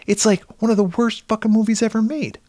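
high-pitched retold insistence; retold emphatic rant. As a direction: retell your rant, pitched right up. Retold rant to his son.

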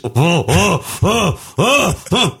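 rhythmic high-pitched fake moans; climbing, hammed-up moans of pleasure; mock sex moans. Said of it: "doesn't communicate in the bed".

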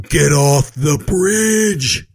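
slow, high, loud bark; yelling guard. Retold or imitated. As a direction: bellow it like a bossy guard. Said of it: "this guy yells out".

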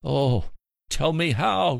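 high, anguished plea; desperate high cry of a dying sinner; tortured cry. Acted. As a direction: cry out in torment, begging for an answer. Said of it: "came the tortured cry".

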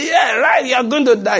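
high, loud, jeering shout; taunting devil. Retold or imitated. Devil telling a sick man he will die, shrill jeer.

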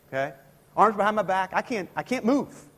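ordinary talk

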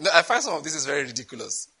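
ordinary talk

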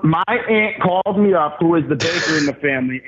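usual speaking voice

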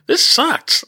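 high-pitched mock complaint; mock whine. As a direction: squeak it out in fake dismay.

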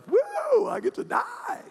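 high, airy squeal of mock delight; gleeful high whoop at the news; excited woo. Man told of his death, squealing with glee.

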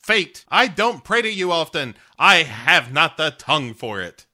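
mock-heroic prayer, high and loud; Grumpy Cat. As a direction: declaim it like a grand plea to fate. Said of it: "Grumpy Cat makes a prayer to fate".

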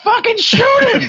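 high-pitched frantic movie-audience yell; mock shriek, way up high; frantic urging. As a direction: egg him on in a squeaky mock scream. Acts audience cipher urging a shot.

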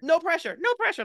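squeaky high no pressure; mock panic. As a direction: echo it in rising panic. Echoing the tease.

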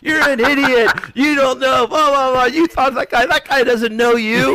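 high, loud mocking rant; Deegan's loud ridicule. Rider acts out teammate ruining him in front of everyone, loud ridicule. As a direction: imitate a friend publicly tearing you down. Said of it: "Deegan just ruins me in front of everyone".

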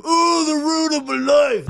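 high, slow, loud melodramatic wail; mock whiny fan. Mocking a fan who cries over Ghostbusters remake. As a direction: ham up a fan's tragic lament.

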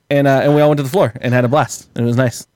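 ordinary talk, nothing marked

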